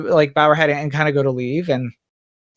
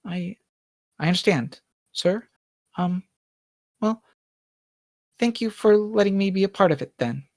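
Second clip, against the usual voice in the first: thoughtful maid Bonnie; 5 semitones higher than usual. Acted. Maid accepts her master's words.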